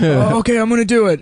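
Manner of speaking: put-on high-pitched boy bracing for a stunt; young amateur daredevil announcing himself; kid psyching up. Acted kid about to do stunt.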